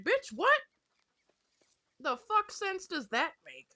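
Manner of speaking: high, loud disbelieving snap; baffled and fed up; incredulous outburst. Real, not acted. Ranting to viewers, loud high disbelief. Ranting about childhood trauma trend.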